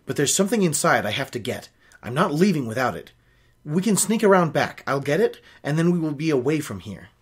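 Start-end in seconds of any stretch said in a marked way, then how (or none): none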